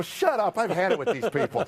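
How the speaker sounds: high, worked-up mock rage; retold angry outburst. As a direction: bark it back at the accountant, half laughing. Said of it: "this is when I lost it".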